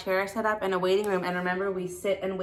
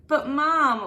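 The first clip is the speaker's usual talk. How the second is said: put-on high, louder child's whine; whining; 8 semitones higher, 5 dB louder than usual. A narrator's reading. Froggy whines to his mother about the pants. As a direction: whine it like a sulky kid. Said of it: "whined Froggy".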